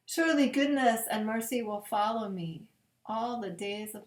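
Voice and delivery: slow, reverent psalm reading with long pauses. Acted. Pastor reading Psalm twenty-three at bedtime, slow and reverent. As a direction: let each verse land gently, slowly.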